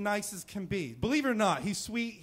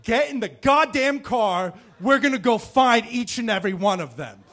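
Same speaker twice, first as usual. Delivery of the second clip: booming, fed-up father rallying his son; angry dad; 3 semitones higher, 9 dB louder than usual. Retold or imitated. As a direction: bark it like a dad out for revenge. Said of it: "And he said".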